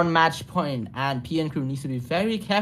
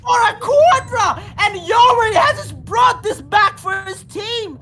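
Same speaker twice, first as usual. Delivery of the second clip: screaming the clutch call, pitched way up; hype shout; 4 dB louder than usual. Real, not acted. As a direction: yell it like the round just flipped. Caster hyped clutch call.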